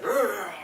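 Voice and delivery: high, loud mock growl; imitated growl. Retelling the tusk stabbing, imitated growl. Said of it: "and he's like".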